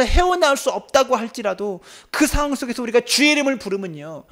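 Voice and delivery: high, loud climax; fiery preaching. Real, not acted. Pastor rousing the congregation, full force. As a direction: drive it home with fire.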